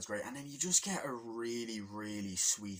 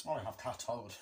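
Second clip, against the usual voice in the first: normal talking voice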